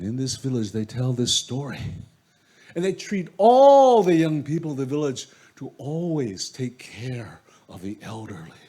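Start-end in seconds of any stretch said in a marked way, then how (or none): none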